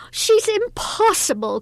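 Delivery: high, loud, exasperated mistress; dramatic outburst of complaint; exasperated cry. A narrator's reading. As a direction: cry out at the end of your patience. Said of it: "cried the mistress".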